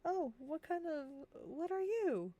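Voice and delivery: high, prying tavern-goer voice; tavern-goer's question. Pretend. Player asking the odd stranger what they are, high mock curiosity. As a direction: playfully act out a curious questioner.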